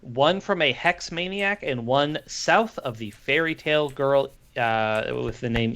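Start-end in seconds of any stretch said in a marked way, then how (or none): none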